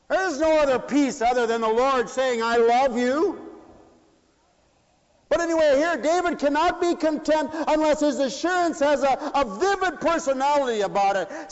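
high, loud, ringing sermon; preaching at full force. Acted. Preacher to congregation, preaching at full force.

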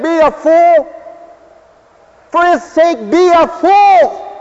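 high, loud preacher's call; fervent preaching. Acted. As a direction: preach it out with fire. Preaching at full force.

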